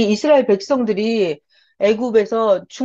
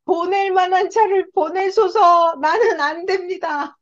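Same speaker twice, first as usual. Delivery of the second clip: high, slow, drawn-out plea to God; pleading Moses; 8 semitones higher, 4 dB louder than usual. Acted. Moses begging God to send someone else, high drawn-out pleading. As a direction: cry out in protest, stretching the words high. Acts out Moses refusing God's call.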